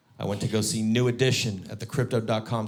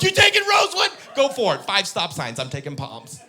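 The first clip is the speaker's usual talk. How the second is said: high loud mocking laugh-shout, fading; gleeful gloating shout then settles; cocky taunt; 9 semitones higher, 6 dB louder than usual. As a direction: laugh at his bad route. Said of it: "I'm like".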